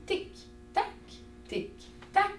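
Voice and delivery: high, soft clicking tic-tac; ticking clock. Shown as a demonstration. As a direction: whisper the tick of a clock. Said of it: "l'histoire de mon horloge". (tic-tac chuchoté et aigu)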